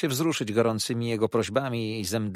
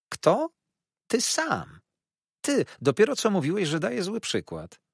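high, louder indignant outburst; indignant young wife; 12 semitones higher, 5 dB louder than usual. A narrator's reading. Young wife accusing her husband, high indignant voice. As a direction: fire the accusation straight back, high.

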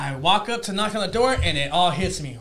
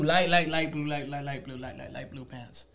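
trailing repetition; 4 semitones lower, 6 dB quieter than usual. Real, not acted. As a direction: repeat it, fading from normal to very soft. Repeats words, dwindling to nothing.

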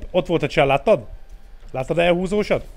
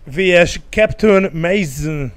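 slow, loud announcer match intro; excited sports commentary; 3 semitones higher, 6 dB louder, slower than usual. Acted. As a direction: announce the match like a stadium host. (izgatott sportkommentátor)

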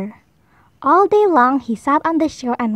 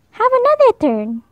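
higher, friendly rabbit voice; encouraging rabbit; 8 semitones higher than usual. Acted. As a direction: cheer him on like a small bunny.

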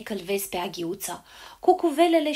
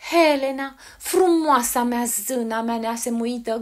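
început tare și rar, apoi mai domol; cheam-o cu adorație teatrală, apoi înmoaie glasul; declarație înflăcărată; 6 dB louder than usual. A narrator's reading. Demetrius vrăjit își declară iubirea. (îndrăgostit în genunchi, ușor teatral)

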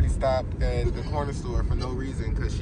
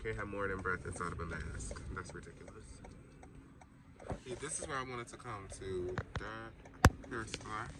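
quiet; 9 dB quieter than usual. Real, not acted. Quiet in a store.

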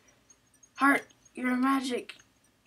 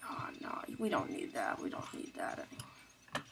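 whispering; 10 dB quieter than usual. Real, not acted. Whispering to self while operating.

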